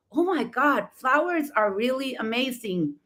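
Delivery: ordinary talk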